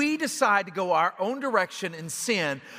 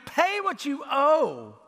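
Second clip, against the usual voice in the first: raised, slow, throttling demand, voice changed; harsh demand; 9 semitones higher, 6 dB louder, slower than usual. Acted. Servant choking his fellow servant, harsh slow demand. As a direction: grab him by the throat with the words.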